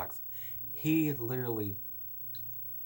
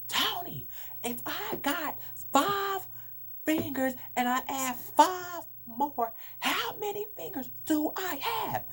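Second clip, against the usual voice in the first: much higher, louder, slow, exasperated teacher; frustrated teacher; 6 dB louder, slower than usual. Retold or imitated. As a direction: drag it out like a teacher losing patience. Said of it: "she got so frustrated with me".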